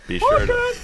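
high squeaky Toad voice. Acted. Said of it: "do it in the Toad voice".

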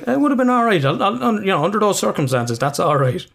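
lightly voiced girlfriend, unbothered; girlfriend's reassurance.

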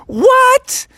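very high, loud squawk; shocked class. Acted. Whole class hearing about endless homework, shocked high cry. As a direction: blurt it in disbelief.

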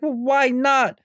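high-pitched mock outburst; freaking out. Joker questioning Harley on the phone, mildly freaking out. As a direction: pitch it up, a touch exasperated. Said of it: "And he freaks out".

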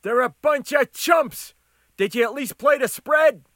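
high, loud customer voice; shouting back. Acted. Crisper yells at cook about team, loud shout. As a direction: shout scorn through the window.

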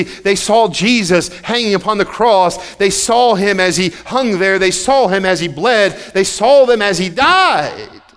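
loud preaching. Acted. Preacher reading Luke then retelling the crucifixion, loud and insistent. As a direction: proclaim it to the back row.

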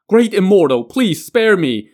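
high, loud, desperate plea; begging yell. A narrator's reading. Trapped creature begs Jiang Ziya for mercy, loud and high. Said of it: "The creature now yelled".

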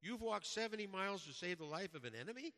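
high, loud amazed question; astonished Washington. Retold or imitated. Washington amazed at the preacher's journey, high and loud. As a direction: sound stunned by the long walk. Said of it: "cried Washington".

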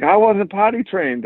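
high mimicked kid voice; defiant young child. Four-year-old arguing with his dad, imitated defiant child. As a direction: argue back in a put-on child's pitch. Retold quote of young son.

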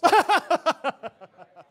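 loud, high put-on cackle trailing away; sarcastic laugh. Shown as a demonstration. Mourners' response to Jesus, hammed-up sarcastic laugh. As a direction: force out a mocking, drummed-up laugh. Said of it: "That's my best sarcastic laugh I can drum up".

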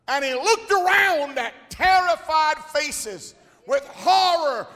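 high, loud shouted preaching; preaching at full force. Acted. Preacher telling the storm scene, loud preaching. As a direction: paint the terror big.

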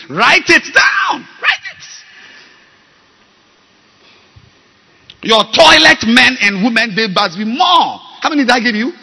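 loud, high commanding preaching; shouting instructions from the pulpit; preaching at full force. Real, not acted. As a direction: bark orders at the congregation.

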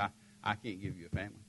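very soft, low admission; retold tearful confession. Wife admitting she can't give him a family, subdued sorrow. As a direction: let it sink down in quiet, understated sorrow. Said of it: "she's just sobbing".

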